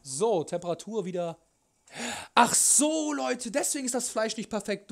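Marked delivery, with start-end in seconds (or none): 2.3-4.9 sudden high "ach so"; realising a mistake, startled; surprised. Real, not acted. Sudden realisation while cooking. (überrascht)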